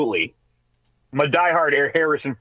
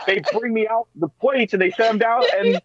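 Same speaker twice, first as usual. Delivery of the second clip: raised, animated outrage, higher; indignant; 6 semitones higher than usual. Real, not acted. Husband complaining to co-hosts about the bread, raised and indignant. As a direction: act wronged by the toast.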